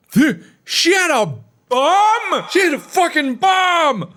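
high-pitched shocked yelp; shocked high shout. Acted. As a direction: squeal it out in alarm. Guard shocked by bomb.

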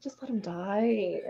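soft slow deadpan. Retold or imitated. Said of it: "I was like".

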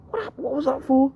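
very high-pitched, hurt squeal of protest; shocked protest. Retold or imitated. Teen to granddad after being boxed on the head, shocked high protest. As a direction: imitate a startled, indignant yelp.

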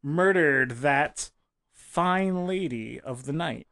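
drawn-out slow confession. Pretend. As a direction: drag the words out slowly. Mock trucker confession.

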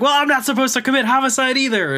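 high-pitched imitated comeback; mock exasperated retort. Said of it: "he grabs the sword and says".

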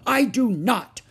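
high, loud, defiant denial; squabbling kid's yell; shouting. A narrator's reading. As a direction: shout back like a cross child. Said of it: "shouted Ramona".

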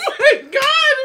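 laughing exclaim; excited. Real, not acted. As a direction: burst out, amazed. Amazed by the snack, laughing.

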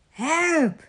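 high, mildly alarmed yelp; storybook cry of alarm; cry for help. A narrator's reading. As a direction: call out for help, pitched high. Toad dazzled, cries for help.

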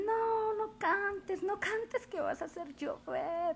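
high, whiny kids' plea, playfully hammed up; children begging. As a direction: mimic little kids begging her to stop singing. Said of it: "ellos me dicen".

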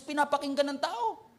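usual speaking voice

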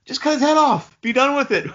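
loud, high exasperated outburst. Real, not acted. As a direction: yell it in frustration. Exasperated at the film.

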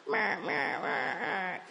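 drawn-out mock comeback; mocking retort. Stoned customer answering the shop worker, mocking retort. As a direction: draw it out to mock her.

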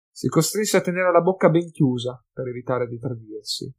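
ordinary delivery, nothing marked

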